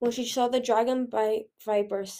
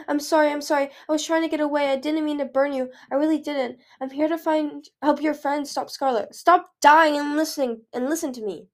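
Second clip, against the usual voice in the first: higher-pitched frantic pleading; distressed cry; 5 semitones higher, 5 dB louder than usual. A narrator's reading. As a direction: plead desperately for forgiveness. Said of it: "Peril cried, landing behind him".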